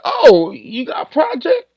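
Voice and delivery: imitating someone jumping at a project; high playful impression of an eager collaborator; eager yes-man.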